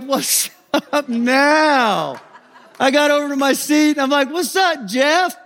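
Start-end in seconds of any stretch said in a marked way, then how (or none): 0.0-2.2 high, drawn-out cocky taunt; strutting, exaggerated greeting; swagger. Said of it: "the swagger I had on the bus". 4.3-5.3 high-pitched cocky taunt; gloating, exaggerated hello; swagger. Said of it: "the swagger I had on the bus".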